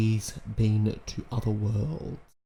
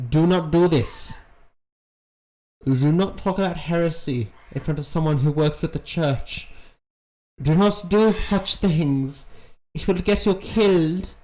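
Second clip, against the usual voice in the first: scolding warning; 6 semitones higher than usual. Acted. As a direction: scold her like a worried elder. Scolding the character on screen.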